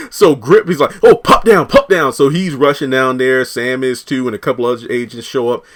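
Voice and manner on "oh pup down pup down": loud, high mock radio-style alert; urgent alarm call. Reviewer acts out Grip reporting a man down, loud urgent call.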